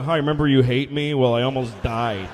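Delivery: loud, low mock greeting; low-pitched, blunt caller; awkward phone call. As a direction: deliver it deadpan to an ex. Imagined call to ex-spouse.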